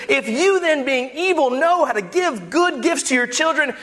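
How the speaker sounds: high, hard-driven pulpit delivery; impassioned preaching. Acted. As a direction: drive the verse home to the congregation.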